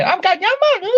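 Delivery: hammed-up, high-pitched Geordie; Geordie accent. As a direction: climb into a squealing mock Geordie. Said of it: "if you go to Newcastle, it's like".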